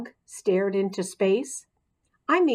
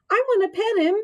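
put-on eager little girl; 12 semitones higher, 3 dB louder, much faster than usual. A narrator's reading. Eager girl Mandy voiced.

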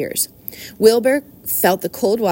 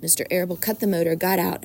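usual speaking voice

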